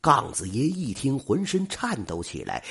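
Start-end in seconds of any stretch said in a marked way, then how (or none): none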